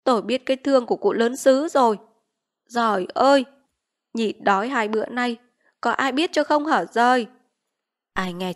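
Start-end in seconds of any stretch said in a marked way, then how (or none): 0.0-7.3 moaning lament. Acted. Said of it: "Chị Vít rền rỉ".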